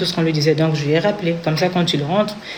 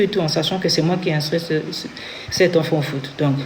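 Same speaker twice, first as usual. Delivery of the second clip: normal talking voice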